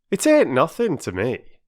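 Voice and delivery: a hurt porter pretending indifference; sulky porter. Perks to the children, sulky and offended.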